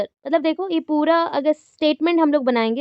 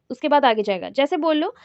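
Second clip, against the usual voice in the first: normal talking voice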